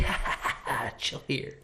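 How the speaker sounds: breathy mock laughter, then soft. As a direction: laugh it off, quiet and smug. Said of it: "I'd be like".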